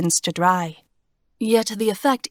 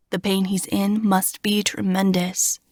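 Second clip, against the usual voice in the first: ordinary delivery, nothing marked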